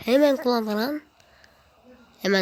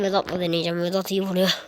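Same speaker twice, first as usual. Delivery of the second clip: ordinary delivery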